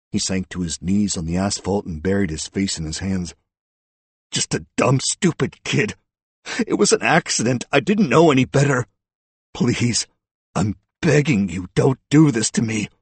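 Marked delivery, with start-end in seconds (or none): 4.3-12.9 raised, strained-with-pleading voice; desperate begging. A narrator's reading. Suspect on his knees confessing, desperate begging. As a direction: beg them for mercy.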